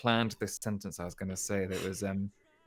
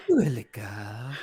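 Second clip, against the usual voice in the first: slow, louder mock-seductive French; sensual tape woman; 6 dB louder, slower than usual. Mimicking the woman on the French tape, sensual. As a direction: stretch it out like a sultry tape voice. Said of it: "in a really sensual way".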